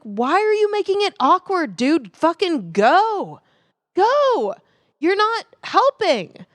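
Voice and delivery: high, exasperated retold outburst; high-pitched exasperation, stretched at the end; retold annoyed yell. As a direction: snap at an awkward driver, reenacted. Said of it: "Did you yell at that to him?".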